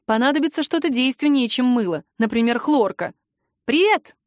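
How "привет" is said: высокое, громкое «Привет!»; радостно окликнуть питомца; радостное восклицание. A narrator's reading. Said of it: "воскликнула я выбежавшему навстречу крысю". (радостно и громко поздороваться с крысом)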